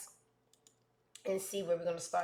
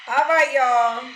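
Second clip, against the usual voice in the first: hyped show opener; 5 semitones higher, 12 dB louder, slower than usual. Real, not acted. Host opens the show loudly.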